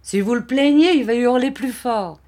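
high, loud, cross warning; irritated retort. A narrator's reading. Barberin answers Vitalis's pity, irritated.

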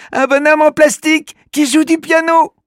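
put-on high, wondering child voice; amazed little girl. Alori discovering the tiny pianist, amazed girl voice. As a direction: gasp in wonder at the sight. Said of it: "murmure Alori".

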